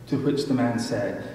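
usual speaking voice

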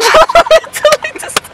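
loud, very high overwhelmed laugh. Real, not acted. Fan overwhelmed by seeing llamas, high laugh. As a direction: burst into squealing laughter.

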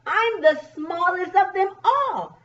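high-pitched little bug voice; lifted storybook boast; smallest bug. Acted. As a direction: announce it proudly as the tiniest bug.

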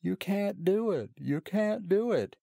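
high taunting sing-song; mocking. A narrator's reading. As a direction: mimic him with a high sneer. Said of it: "he mocks".

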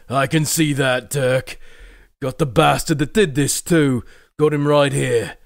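loud, gruff captain; grim commander Morgrig. Acted. Morgrig tells Dirk he caught the attacker, loud gruff captain. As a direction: grimly point out the culprit.

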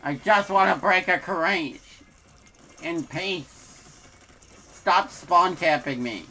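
loud exasperated complaint; frustrated yelling. Real, not acted. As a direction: vent at the game, slow on the last line. Frustrated gamer yelling at enemies.

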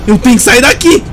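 high, frantic repeated cry; desperate urgency. Real, not acted. Desperate to escape in game. (desespero, voz alta e aguda)

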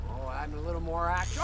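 normal talking voice

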